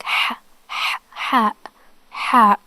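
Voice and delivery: "ha" begun as a long breathy throat hiss; Arabic ḥa sound. Shown as a demonstration. Teacher helping learners start the letter, hissed constricted ḥa. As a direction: hiss from mid-throat into each "ha", separately. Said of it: "start with pronouncing letter ha".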